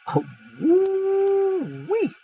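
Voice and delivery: long, high drawn-out woo-wee; excited woo-wee. Real, not acted. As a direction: stretch the woo-wee way up in amazement. Preacher reacts to scripture.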